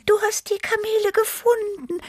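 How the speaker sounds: very high, jubilant little cat voice; hopeful cheer. A narrator's reading. Tibi hopes Chili found the camels, cheering. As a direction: squeal it with joyful hope. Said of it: "jubelte Tibi hoffnungsvoll".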